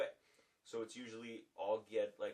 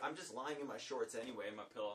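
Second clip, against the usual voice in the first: usual speaking voice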